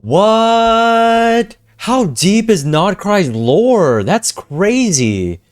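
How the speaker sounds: slow, stunned what; shocked. Real, not acted. Streamer stunned by the lore reveal, shocked.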